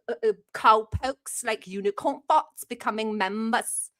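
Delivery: put-on British accent, mock indignant; hammed-up British streamer chat; fake British accent. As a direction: keep up a prank British accent. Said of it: "I'm not really British".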